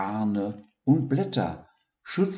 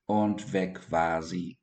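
ordinary delivery

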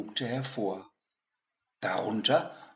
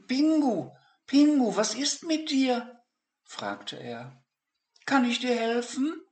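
put-on high, louder child's voice, anxious; high boy voice, concerned; worried boy; 14 semitones higher, 5 dB louder than usual. Boy worried about his penguin.

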